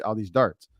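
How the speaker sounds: ordinary talk, nothing marked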